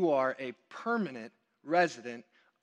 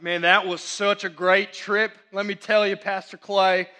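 louder, put-on Southern twang; strong Southern accent; 2 semitones higher, 7 dB louder than usual. Students to their pastor on the way home, hammed-up Southern accent. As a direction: mimic kids who picked up a Southern accent. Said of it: "Why are you talking like that?".

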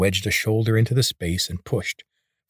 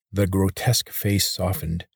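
ordinary delivery, nothing marked

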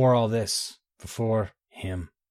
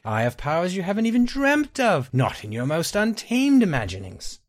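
high, soaring villain's persuasion; fervent, scheming Nightingale; 8 semitones higher than usual. Acted. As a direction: sweep her along with grand promises.

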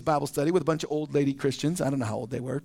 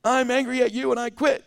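higher, louder whiny little tantrum; mock baby tantrum; 6 semitones higher, 4 dB louder than usual. Preacher mocking a spiritually immature believer, whiny tantrum. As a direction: pout it out like a sulking baby in Christ. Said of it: "stomp my feet like a little baby in Christ".

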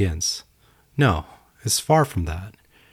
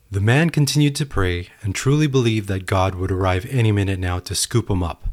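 ordinary talk

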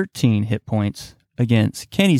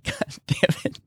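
laughing breathless; 3 semitones higher, 7 dB quieter than usual. Real, not acted. Laughing too hard to speak.

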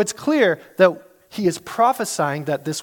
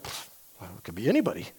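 dismissive shrug; 10 semitones lower, 9 dB quieter, faster than usual. Acted. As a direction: puff of air, then low mumble. Imagined reader shrugs at prophecy.

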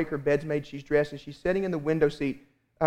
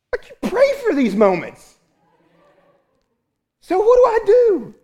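mock exasperation; 7 dB louder than usual. Preacher acting out his dilemma.

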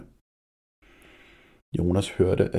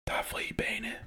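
breathless whisper; 12 dB quieter than usual. A narrator's reading. Boy whispering to the others at the door, whisper. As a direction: whisper it so no one wakes. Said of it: "hviskede han til de andre".

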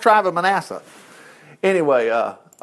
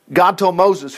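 ordinary delivery

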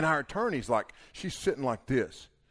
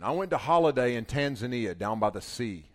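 normal talking voice

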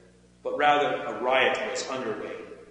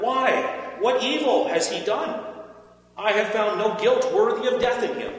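raised, higher-pitched protest; insistent objection pitched up high; 9 semitones higher, 4 dB louder than usual. A narrator's reading. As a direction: challenge the crowd, pleading the man's case. Pilate challenges the crowd's demand.

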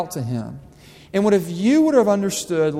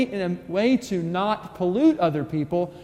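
ordinary talk

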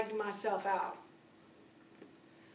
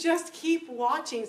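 slow, drawn-out patient reply; calmly insist, taking time; patient God; 4 semitones higher, 3 dB louder, slower than usual. Retold or imitated. God telling her to keep watching, slow and patient. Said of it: "And He goes".

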